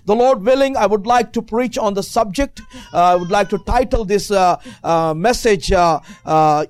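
normal talking voice

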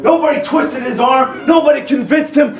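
high, shouted preacher's proclamation; full-force preaching. Real, not acted. Preacher declaring salvation by grace to the church, fervent shouting. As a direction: proclaim it at a sustained high pitch.

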